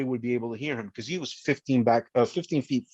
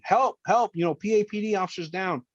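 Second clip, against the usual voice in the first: mock yell for help; 7 semitones higher than usual. Officer retelling his cry to Marines, mild yell. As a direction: call out to rescuers above. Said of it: "I started yelling".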